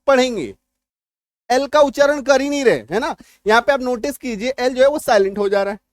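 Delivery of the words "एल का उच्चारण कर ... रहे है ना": ordinary talk, nothing marked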